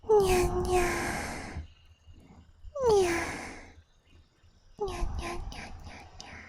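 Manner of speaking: anime-style cat nyas; chirpy nya, nya sounds. Pretend. Offering viewers cute nya sounds instead of meows. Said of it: "Or do you prefer the".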